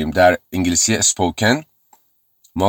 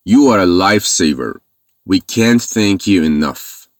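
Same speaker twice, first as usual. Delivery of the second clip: very slow, careful repetition; slow model reading; much slower than usual. Shown as a demonstration. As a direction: read both sentences slowly for learners.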